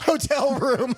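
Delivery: high, laughing echo of a slip; teasing through helpless laughter. Real, not acted. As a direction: crack up while repeating the slip.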